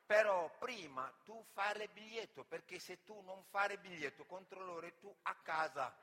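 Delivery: loud, simplified foreigner-talk; mock broken Italian. Milanese local gives directions to the immigrant, loud mock foreigner-talk. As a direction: bark directions in baby Italian.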